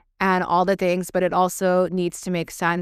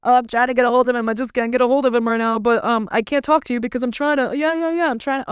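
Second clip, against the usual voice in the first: fast, higher, whiny stalling excuse; mock politician; 5 semitones higher, faster than usual. Mocking the governor avoiding calls, fast and higher-pitched. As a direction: rattle off a flustered politician's brush-off. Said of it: "he's like".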